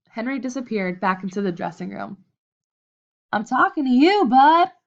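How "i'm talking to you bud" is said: loud, higher and insistent; angry demand. A narrator's reading. Red confronting Bud who ignores him, loud and demanding. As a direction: make him listen to you.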